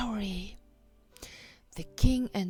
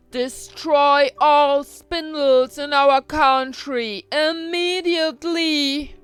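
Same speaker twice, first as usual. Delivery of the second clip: loud, raised kingly order; royal command; 6 semitones higher, 10 dB louder than usual. A narrator's reading. King orders all spindles destroyed, commanding. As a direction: proclaim it forcefully to the whole country. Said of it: "gave the command".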